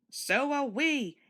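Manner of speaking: high, slow called-out reply; distant cheerful call. A narrator's reading. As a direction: call from behind the cottage. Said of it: "came a voice".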